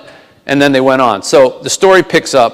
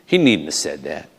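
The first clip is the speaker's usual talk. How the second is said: lower, softer Southern storytelling aside; Southern accent; 6 semitones lower, 5 dB quieter than usual. Acted. Band member sets up the manager's warning, Southern accent. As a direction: drop down, keep the put-on Southern accent. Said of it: "I'll do my worst Southern accent".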